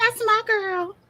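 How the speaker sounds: high proud cheer; proud parent. Pretend. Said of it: "Being like".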